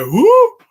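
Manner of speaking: loud whoop shooting up high; sudden high, loud cartoon whoop; mock startled whoop. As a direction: whoop like an exaggerated reaction shot. Imitates a movie reaction shot.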